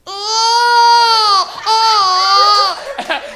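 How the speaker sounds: put-on loud, high baby wail; imitated baby cry. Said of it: "I can cry like a baby".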